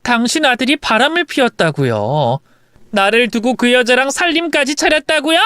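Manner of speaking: mildly indignant wife standing up to her; defiant accusation. Acted. Wife telling mother-in-law of the affair, defiant. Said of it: "삿대질을 하며 시어머니에게 대들었습니다".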